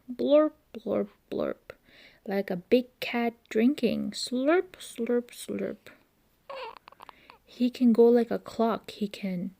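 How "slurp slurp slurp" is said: ordinary delivery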